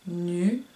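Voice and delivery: slow split "Nuage", lingering on its last sound; stretched final J. Shown as a demonstration. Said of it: "dans la deuxième qu'on entend".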